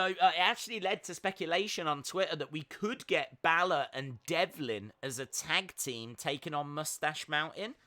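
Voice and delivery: ordinary talk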